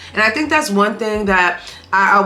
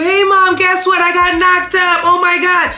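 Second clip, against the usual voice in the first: much higher and louder, hammed-up teen blurting news; mock excited confession; 10 semitones higher, 5 dB louder than usual. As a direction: play a giddy daughter telling mom, overdone. Mock confessing pregnancy to mom.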